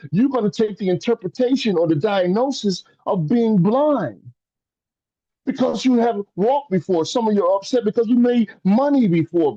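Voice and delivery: high, climbing preacher's cry; preaching at full force. Acted. Preacher rouses his livestream audience about worth, fervent and rising. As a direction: build to a fervent peak.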